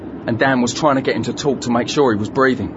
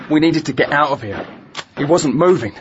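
usual speaking voice

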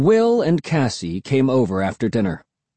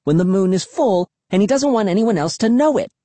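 put-on insistent girl; 9 semitones higher than usual. A narrator's reading. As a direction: press the point, high and urgent. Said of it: "Cassie demanded of Will".

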